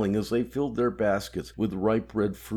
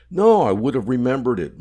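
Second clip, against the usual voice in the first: louder, higher insistence; loud protest; 5 semitones higher, 10 dB louder than usual. Acted. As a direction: insist loudly. Mahmoud protests to George.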